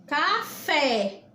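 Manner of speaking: café drawn out, the final fé stressed; stress on last syllable. Shown as a demonstration. Teacher proving which syllable is stronger, exaggerated stress on the fé of café. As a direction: stretch café and land hard on the fé. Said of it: "é a sílaba mais forte".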